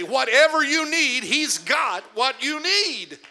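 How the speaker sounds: loud, rising preacher's cadence; full-force preaching. Real, not acted. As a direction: preach it out, building to a climax.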